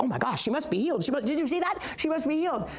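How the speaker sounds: quick, high, excited chatter; high-pitched breathless-paced excitement; excited onlookers. Retold or imitated. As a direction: imitate friends bursting with amazement. Excited friends crowding round her.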